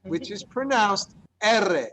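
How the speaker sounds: R said as a long trilled erre; rolled double R. Shown as a demonstration. Spanish teacher demonstrating the trilled double R, slowly. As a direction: roll the R slowly, tongue fluttering. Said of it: "and the double R".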